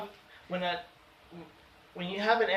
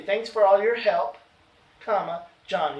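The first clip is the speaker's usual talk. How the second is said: louder "help", pause, then "comma, John"; slight comma pause; 5 dB louder than usual. Shown as a demonstration. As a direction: break after "help" and voice the comma. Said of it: "You hear that slight pause?".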